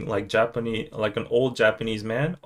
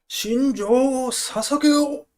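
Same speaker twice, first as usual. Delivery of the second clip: high, slow, drawn-out rallying cry; anime battle cry; 11 semitones higher, much slower than usual. Acted. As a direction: stretch it out like a rousing anime salute. Quotes Attack on Titan battle cry.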